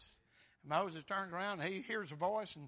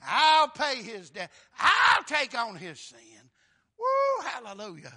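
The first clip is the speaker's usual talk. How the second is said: loud, stretched-out preaching cry; 4 semitones higher, 12 dB louder, slower than usual. Acted. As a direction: drive it home to the congregation. Preacher at full force.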